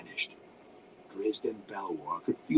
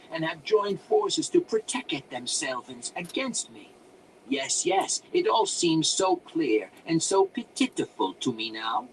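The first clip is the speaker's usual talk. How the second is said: musing to himself; 6 semitones higher, 7 dB louder than usual. A narrator's reading. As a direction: ramble self-importantly as an eccentric wizard. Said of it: "mused, more to himself than to the intruders".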